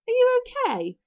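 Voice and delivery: high, loud anxious question; concerned Fishlegs. A narrator's reading. Fishlegs checking on bitten Hiccup, high and concerned. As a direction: ask in a high-pitched worried boy's voice. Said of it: "asked Fishlegs".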